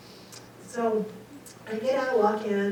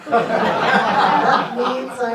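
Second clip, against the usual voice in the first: laughing; 10 dB louder, much slower than usual. Real, not acted. Laughing at absurd sign.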